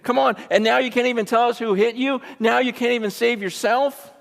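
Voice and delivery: high-pitched, incredulous taunting; mocking. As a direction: imitate hecklers throwing his claim back at him. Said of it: "the people here are mocking him".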